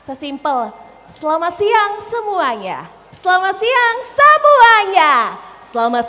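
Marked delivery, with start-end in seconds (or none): 1.1-2.9 "Selamat siang semuanya" drawn out on a high, arching tune; varied intonation. Shown as a demonstration. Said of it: "variasi atau lekuk-lekukan nada". 3.1-5.1 "semuanya" punched very high and loud, drawn out; big intonation swell. Shown as a demonstration. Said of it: "variasi atau lekuk-lekukan nada".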